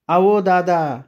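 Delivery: high, distant call falling away; ghostly woman calling. Acted. The unseen woman calls the narrator again, high and eerie.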